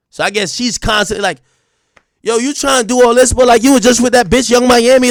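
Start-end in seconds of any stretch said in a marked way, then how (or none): 2.2-5.1 put-on nagging girlfriend, higher and louder; chirping girlfriend. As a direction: complain like a jealous girlfriend. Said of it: "when a girl start chirping".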